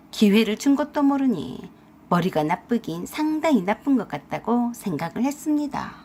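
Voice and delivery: usual speaking voice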